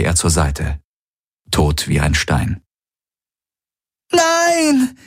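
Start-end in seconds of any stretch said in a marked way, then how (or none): 4.1-5.0 high-pitched, horrified scream. A narrator's reading. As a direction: scream out at seeing a death. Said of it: "schrie Kirai". (entsetzter hoher Schrei)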